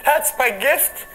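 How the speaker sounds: real overwhelmed joy close to crying; tearful, laughing disbelief. Real, not acted. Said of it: "this part makes me wanna cry".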